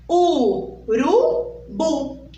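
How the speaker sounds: very slow "Urubu" in three beats; syllable by syllable. Shown as a demonstration. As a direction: pout and count "Urubu" out. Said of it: "abri a minha boquinha três vezes". ("Urubu" bem devagar, em três sílabas)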